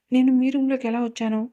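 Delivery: usual speaking voice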